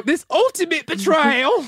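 high, slow mock-British delivery; fake British accent. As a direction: draw it out in bad stage British. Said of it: "my favorite British accent".

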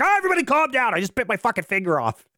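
high imitated yelp that eases down; mock frantic appeal. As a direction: plead for calm in a high, hammed-up cry. Acting man defusing standoff, finger bitten.

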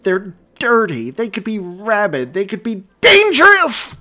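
building scream. A narrator's reading. Mary screaming about the animals in labor. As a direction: panic spilling into a scream. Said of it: "trailed away with another scream".

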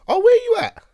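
high, put-on innocent whine; mock nosy friend. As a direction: ask it like a fake-naive friend. Mocking a nosy Snapchat friend.

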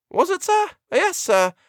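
very high-pitched servant voice; flustered housekeeper. A narrator's reading. Housekeeper nervously answering the doctor, high flustered servant. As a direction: answer the master in a high put-on voice.

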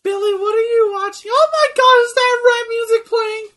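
very high, loud shrieking parent; scandalized parent. Pretend. Parent catching Billy playing rap music, shrill mock outrage. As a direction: screech in mock horror at your kid. Acted scolding mother catching a kid.

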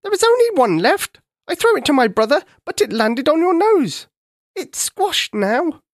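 a monkey complaining to the king about gorillas, high and whiny; moaning monkey. Acted. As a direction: moan like a hard-done-by little monkey. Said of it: "a monkey moaned".

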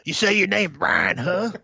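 high sneering taunt before the slap; mock tough wrestler. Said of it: "that's an open-handed slap".

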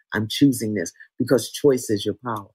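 normal talking voice

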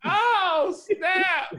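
high, loud Oh snap shout; excited shout. Real, not acted. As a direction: shout it out in delight. Reacting to the stutter.

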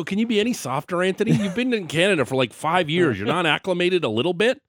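high-pitched incredulous ribbing; retold teasing disbelief. Host retelling ribbing his cold Australian manager, incredulous. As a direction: needle him, voice lifted in mock disbelief. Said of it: "I go".

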